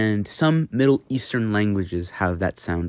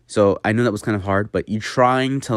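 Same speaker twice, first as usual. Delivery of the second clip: ordinary talk, nothing marked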